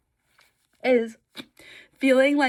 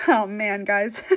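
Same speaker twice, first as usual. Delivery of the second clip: normal talking voice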